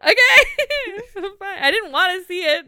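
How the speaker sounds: laughing hard through the words; can barely get it out for laughing. Real, not acted. Laughing fit after gross wink.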